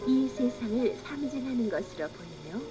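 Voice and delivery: ordinary delivery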